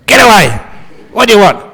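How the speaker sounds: loud, high, violent yell; angry thief shout. Acted. Burglar threatens the abbot with a knife, violent shout. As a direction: snarl him away like a threatened burglar. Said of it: "I'm not allowed to speak like this usually".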